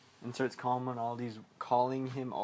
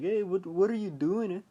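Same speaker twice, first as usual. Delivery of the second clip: high, louder call from an Irish cop; 7 semitones higher, 4 dB louder than usual. Retold or imitated. Cop confronting kids down by the dam, high retold call. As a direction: call out sharply higher, as the cop. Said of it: "this Irish cop is like".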